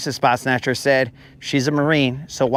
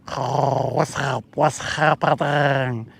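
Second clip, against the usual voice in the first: alien voice; 3 semitones lower, 4 dB quieter, slower than usual. Pretend. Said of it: "In case there's real Drox out there".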